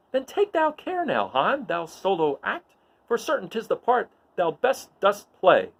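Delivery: high, theatrical Shakespearean delivery; dramatic verse reading. Acted. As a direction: declaim the verse like a stage actor.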